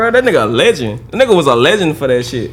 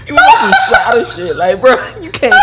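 laughing; 8 semitones higher than usual. Real, not acted. Laughing through punchline.

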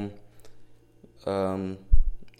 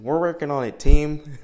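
loud pep talk to a team; rally an imaginary team; mock team leader; 6 semitones higher, 12 dB louder than usual. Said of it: "I sound like a crazy person".

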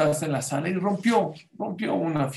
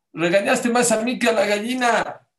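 put-on child complaining; 6 semitones higher, 5 dB louder than usual. Child complaining to his mother.

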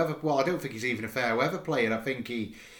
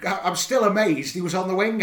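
amazed disbelief; 6 semitones higher, 6 dB louder than usual. Real, not acted. Disbelieving rant about a substitution.

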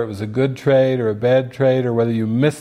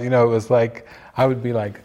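ordinary talk

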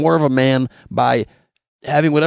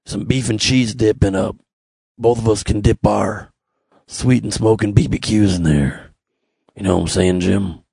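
lower, put-on come-hither voice; mock seductive dude; 6 semitones lower than usual. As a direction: lure Jim into the smokehouse, low and sly. Seductive invite to the smokehouse.

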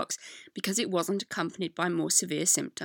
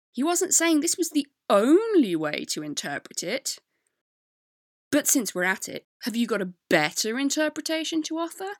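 mock upset; 6 semitones higher, 5 dB louder than usual. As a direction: voice his indignant challenge, hammed up. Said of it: "He's also upset".

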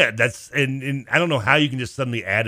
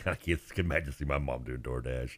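laughing; 7 semitones lower, 11 dB quieter, slower than usual. Real, not acted. Laughing at mom idea.

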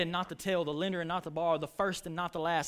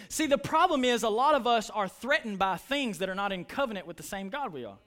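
ordinary delivery

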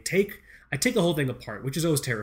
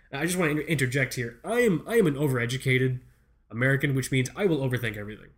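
ordinary talk

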